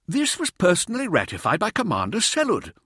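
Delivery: high-pitched objection; affronted official appeal; indignant protest. A narrator's reading. Senior protests the eviction.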